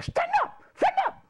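very high mock command; high-pitched caricature of an order; imitating the principal. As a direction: order it in a squeaky put-on principal voice. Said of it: "he kinda talked".

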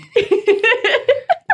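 long high giggle; high laughter. Real, not acted. Laughs at hiccups.

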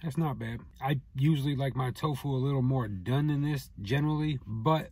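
speaking between bites; talking while chewing. Real, not acted. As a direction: mouth partly full. Seen 3: Bites at -2.0; closed moving lips while talking, food in hand.

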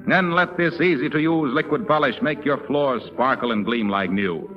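ordinary talk, nothing marked

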